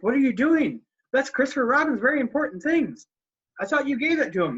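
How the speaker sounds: high, worried bear voice; a simple bear confused; puzzled Pooh. Retold or imitated. Pooh questions Tigger about the briefcase, high puzzled voice.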